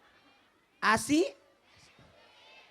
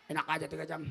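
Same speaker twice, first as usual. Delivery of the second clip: low, softer scoff; mock indignant; 7 semitones lower, 5 dB quieter, faster than usual. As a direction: brush it off scornfully. Mock refusal to audience.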